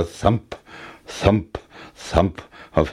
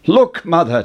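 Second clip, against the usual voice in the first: put-on high excited boy's call; 7 semitones higher, 4 dB louder than usual. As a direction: call out eagerly to mother. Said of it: "Jack cried".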